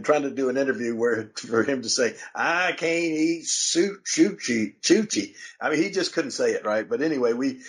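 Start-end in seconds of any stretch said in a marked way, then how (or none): 2.3-5.3 imitating a thick Southern drawl, "sushi" said as shushi, much slower; mock Southern accent, "sushi" starting with sh and rising higher; slow hillbilly impression, "sushi" said shushi; mangled sushi. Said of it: "he could not say the word sushi".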